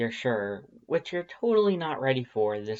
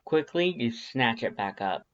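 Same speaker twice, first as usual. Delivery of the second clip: usual speaking voice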